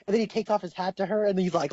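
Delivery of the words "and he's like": normal talking voice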